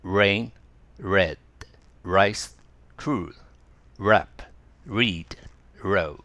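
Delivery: each word starting with a clear English R; careful R sounds for the learner. Shown as a demonstration. Teacher reading R words slowly to the class. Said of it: "がRです。".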